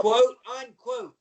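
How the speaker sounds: "quote, unquote" loud, high and drawn out; pointed air quotes. Real, not acted. Guest relaying why Goldberg got favors, "quote, unquote" slow and pointed. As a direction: stretch "quote, unquote" slowly to flag doubt. Stressing a doubtful official reason.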